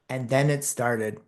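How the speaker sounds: ordinary talk, nothing marked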